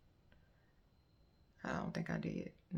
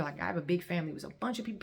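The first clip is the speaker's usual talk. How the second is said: ordinary talk, nothing marked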